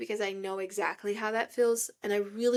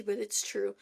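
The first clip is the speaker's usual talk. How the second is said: ordinary talk, nothing marked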